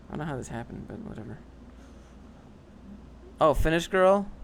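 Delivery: usual speaking voice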